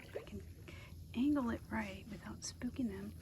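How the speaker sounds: breathless awed whisper. Real, not acted. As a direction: whisper so the birds stay. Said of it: "I don't know why I'm whispering".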